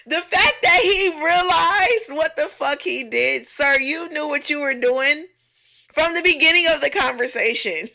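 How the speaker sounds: loud, high, exclaiming after a laugh; amused outrage. Real, not acted. Woman calling out the bar flirt, amused outrage. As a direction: call him out loudly, pitch riding high.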